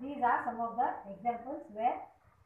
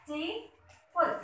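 slowed word; 6 semitones higher, slower than usual. Shown as a demonstration. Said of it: "You have three syllables".

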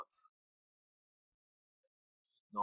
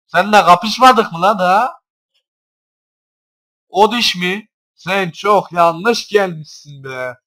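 mocking taunt; 6 semitones higher, 13 dB louder, slower than usual. Real, not acted. Taunting trainer in game.

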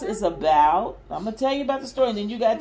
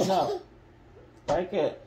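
usual speaking voice

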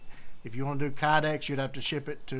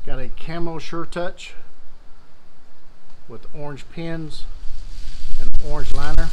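raised voice against the wind; shouting over wind; 15 dB louder than usual. Real, not acted. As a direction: project loudly outdoors. I hope you can hear me over the wind.

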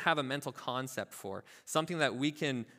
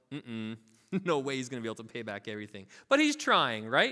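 normal talking voice

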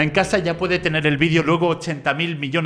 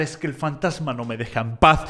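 low, soft imitation of crying complaint; mock tearful; 5 semitones lower, 5 dB quieter than usual. Poor girl complains about the ghost, mock tearful. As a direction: softly whimper it, only half acted. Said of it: "ya se pone a llorar".

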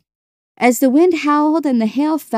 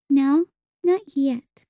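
put-on slow, high child's refusal; deliberate, higher-pitched childish refusal; withholding little sister; 5 semitones higher, slower than usual. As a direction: draw out the no in a small child's voice.

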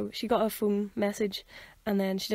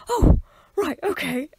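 much higher, louder startled oh; caught-off-guard shock; 8 semitones higher, 9 dB louder, slower than usual. Retold or imitated. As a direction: jolt as if suddenly called up. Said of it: "I was like".

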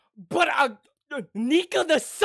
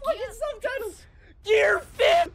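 ecstatic yell; 10 semitones higher than usual. Real, not acted. Fan watching the Gear Fifth reveal, ecstatic cracking yell. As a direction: scream it like your favourite hero just powered up.

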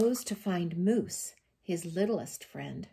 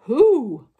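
high, very loud question; bear asking; 6 semitones higher, 16 dB louder than usual. A narrator's reading. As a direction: boom it out as a curious bear. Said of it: "And the bear asks".

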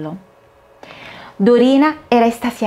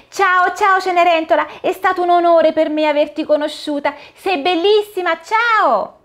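put-on high, excited little girl voice; a thrilled child meeting Cinderella; little girl Dorina; 10 semitones higher than usual. (voce acuta di bambina emozionata)

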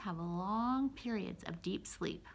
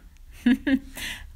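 laugh; 6 semitones higher, 12 dB louder than usual. Real, not acted. Laughing after story ends.

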